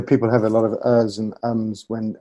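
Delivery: ordinary talk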